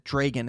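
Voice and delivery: "dragon" said as DRAY-gun; long AY in the first syllable of "dragon"; long-A mispronunciation. Retold or imitated. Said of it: "and he said".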